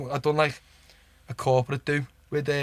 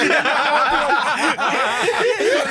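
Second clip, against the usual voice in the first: high-pitched helpless laugh, loud; 12 semitones higher, 6 dB louder than usual. Real, not acted. Recalling the manager's jokes, a loud high laugh. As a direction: burst out laughing at the memory.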